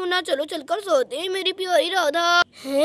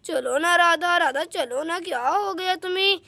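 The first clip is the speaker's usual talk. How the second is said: ordinary talk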